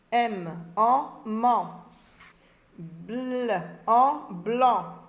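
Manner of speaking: loud, slow letter, "en", then blended syllable; sounding out syllables. Shown as a demonstration. Teacher drilling forming and reading syllables, letters separated then blended. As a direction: spell out ban, lan, pan, blanc piece by piece, firmly. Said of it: "Forme et lit des syllabes".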